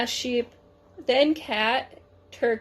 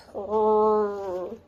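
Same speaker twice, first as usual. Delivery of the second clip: purring engine rumble; cat doing the engine purr; 2 semitones lower than usual. A narrator's reading. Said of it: "I can do the sound the engine makes".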